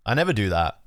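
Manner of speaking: ordinary delivery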